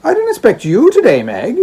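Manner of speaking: lightly voiced surprised old father; an old man greeting his daughter; Trotty, old father. Old father surprised to see daughter, lightly voiced.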